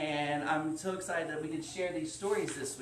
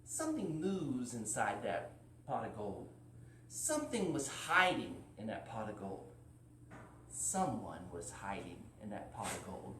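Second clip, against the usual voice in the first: dropping lower and softer, to a hush; building suspense; 6 semitones lower, 7 dB quieter than usual. Acted.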